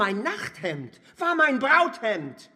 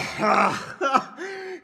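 a laugh that breaks into crying; laugh-sob; 5 semitones higher than usual. Acted. Despairing cripple laughs and sobs at once. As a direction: let the laugh crack into a sob.

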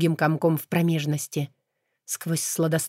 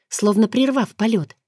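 plain reading voice

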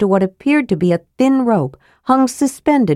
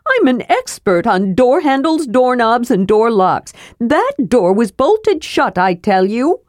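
higher, proud expert protest; kender insisting; 5 semitones higher than usual. Acted. Kender to dwarf, higher proud protest. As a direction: stand on your lock expertise. Narrator voices Tass.

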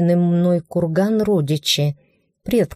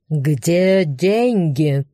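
slow, booming orc demand; thundering orc voice; much slower than usual. Acted. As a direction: boom it like a brutish orc. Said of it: "громовой голос орка".

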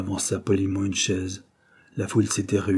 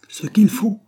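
high, loud incredulous echo; exclaiming; 13 semitones higher, 6 dB louder, slower than usual. A narrator's reading. Brum astonished at Jacob's ignorance, exclaiming. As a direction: throw the question back, pitch leaping up. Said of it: "s'écria Brum".

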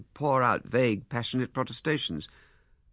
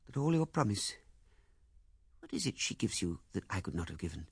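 higher, pleading woman's voice; Miss Heydinger; 7 semitones higher, 4 dB quieter than usual. Acted. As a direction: plead softly as the hurt woman. Female character voice in narration.

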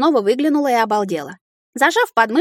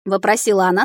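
usual speaking voice

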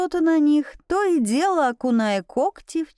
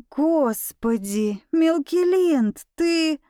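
overwhelmed; 5 semitones higher, much slower than usual. A narrator's reading. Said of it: "От захлестнувших меня эмоций".

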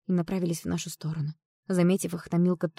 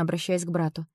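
ordinary delivery

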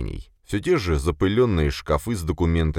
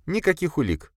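much higher female-character voice; Sofia, resigned; 9 semitones higher than usual. Acted. Sofia reports no evidence to boss, resigned.

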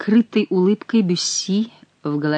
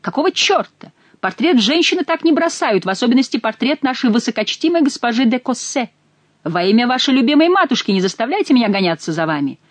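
calling out; 7 semitones higher than usual. A narrator's reading. Stranger still shouting after them.

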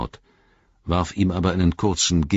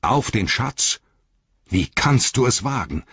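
a higher, stern challenge; sternly rebuke, mildly raised; 6 semitones higher than usual. A narrator's reading. Frodo astonished at Gollum's oath, stern. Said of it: "die Strenge seines Tons".

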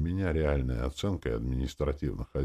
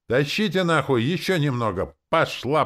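much higher, loud barked orders; yelling; 11 semitones higher, 7 dB louder than usual. Acted. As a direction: bellow at the haulers. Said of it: "и заорали".

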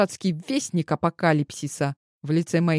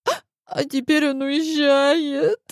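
high wailing sob, pitched way up; high, tearful wail; hiccuping sob; 8 semitones higher than usual. A narrator's reading. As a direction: cry it out with a hiccup. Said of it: "икнула я".

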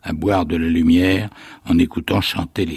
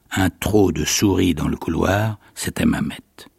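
plain reading voice

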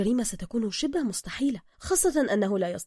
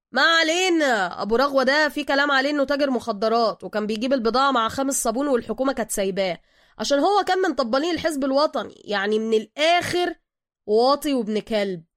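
street hustler; 4 semitones higher, 7 dB louder than usual. Acted. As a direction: talk like a sly street boss. Suka's street-wise voice.

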